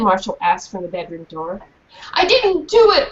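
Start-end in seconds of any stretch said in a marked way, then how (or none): 2.1-3.1 high, loud wailing denial. Acted. Girl wailing her innocence to mom, high and loud. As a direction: cry out your innocence. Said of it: "Rennie wailed".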